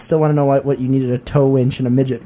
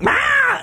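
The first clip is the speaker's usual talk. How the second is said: scream imitation.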